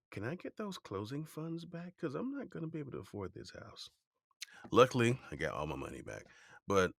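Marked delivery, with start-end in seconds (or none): 0.1-3.9 high, soft, small-voiced request; meek plea. Laid-off employee asking for his funds back, meek high voice. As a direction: ask timidly, pitched way up.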